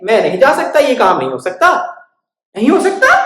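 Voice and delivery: high, loud panicky mouse; frightened refusal. Acted. Dog refusing fearfully.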